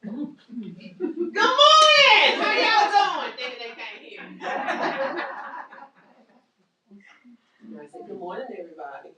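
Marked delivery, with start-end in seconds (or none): 0.0-3.4 very high, loud, booming "Good morning! How y'all doing?"; too loud greeting. Shown as a demonstration. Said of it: "I have a very loud voice". 8.1-9.1 soft greeting. Shown as a demonstration. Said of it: "you wanna use that thing".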